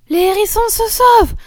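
put-on high, loud girlish cry; exclaiming. Girl crying out as the hedgehogs run away, loud. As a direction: shout the alarm as they escape. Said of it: "s'écria Marguerite".

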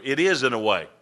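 ordinary delivery

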